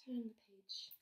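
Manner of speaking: soft, unvoiced whisper. Real, not acted. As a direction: whisper a quick prompt. Whispered prompt while reading aloud.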